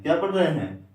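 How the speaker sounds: ordinary delivery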